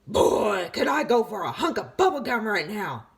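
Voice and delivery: loud, cheeky craving. A narrator's reading. Tooth distracting the batter, loud and cheeky. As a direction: break in mid-pitch with a greedy wish.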